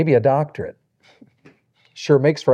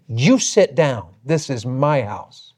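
slow, deliberate imitation of an old hostess; firm scolding; slower than usual. Old woman ordering pastor to stay seated, slow and firm. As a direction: lay down the law slowly.

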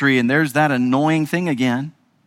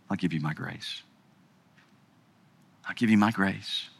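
low and soft; tender promise of grace; hushed reassurance; gentle God voice; 9 semitones lower, 10 dB quieter than usual. Acted. God's voice answering.

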